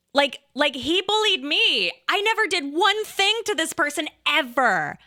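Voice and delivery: hammed-up, high-pitched protest; mock melodrama. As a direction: overplay being the wronged victim. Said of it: "The drama. Ridiculous".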